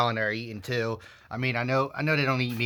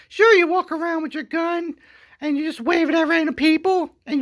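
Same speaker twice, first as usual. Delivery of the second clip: very high, loud mock lady voice; falsetto woman; 9 dB louder than usual. Wife asking security to unload the husband, in a high falsetto. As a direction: chirp it sweetly like nothing happened. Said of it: "she would be like".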